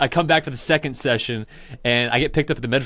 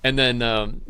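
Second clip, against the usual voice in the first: ordinary talk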